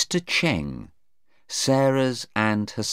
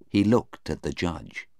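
plain reading voice

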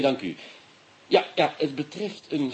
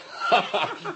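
amused chuckle; 8 semitones higher than usual. Acted. Inspector amused by the parrot.

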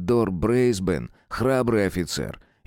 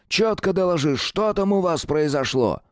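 high, barking sergeant voice; drill sergeant; 9 semitones higher than usual. A narrator's reading. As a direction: bark it at a mumbling private. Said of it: "гаркнул сержант". (сержант гаркает на солдата)

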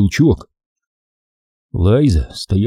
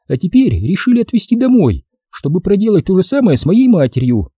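high-pitched female character voice, teasing; sassy lady, pitched high; teasing woman; 10 semitones higher than usual. Acted. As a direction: play a teasing woman well above your own pitch.

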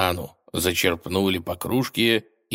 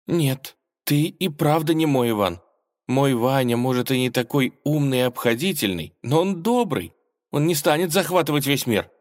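hurt realization; 8 semitones higher, 3 dB louder than usual. Acted. Vasilisa quietly rejects him.

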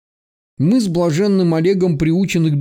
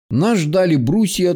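ordinary delivery, nothing marked

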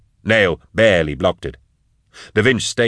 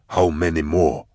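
captor's question; 3 semitones lower than usual. A narrator's reading. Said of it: "he asked".

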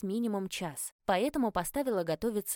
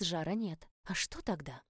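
ordinary delivery

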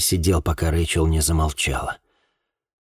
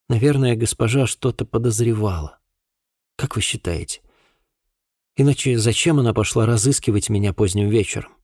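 put-on lightly pitched-up voice for a young woman; pondering girl witness; 5 semitones higher than usual. A narrator's reading. Girl wonders why her mistress came looking, lightly voiced. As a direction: reason it out as the girl, slightly higher.

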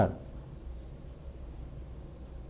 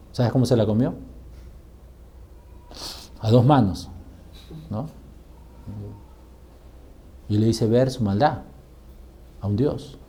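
usual speaking voice

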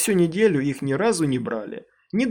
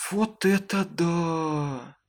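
amazed exclamation; 4 semitones higher, much slower than usual. A narrator's reading. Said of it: "вскрикнула она, поражённая".

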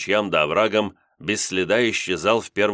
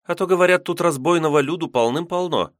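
put-on high, uneasy young girl; anxious girl voice; 11 semitones higher, 3 dB louder than usual. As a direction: warn like a frightened girl. Maiden warns of robbers.

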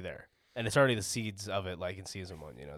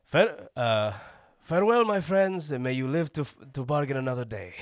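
smuggler voice; 4 semitones higher, 7 dB louder than usual. Pretend. Quoting a smuggler's line.

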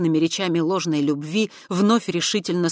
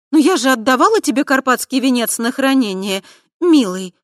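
higher, louder, angry reproach; lashing anger; 9 semitones higher, 10 dB louder than usual. A narrator's reading. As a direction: whip it out in anger. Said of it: "В её голосе хлестнула злость".

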